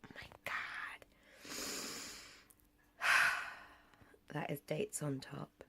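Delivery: soft whisper over the food. Real, not acted. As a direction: whisper it close to the mic.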